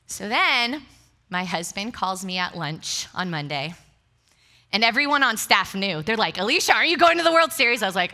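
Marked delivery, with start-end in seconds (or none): 6.4-7.8 high, loud, quick excited question; imitating thrilled colleagues, fast and high; excited coworkers. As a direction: gush it in a loud rush.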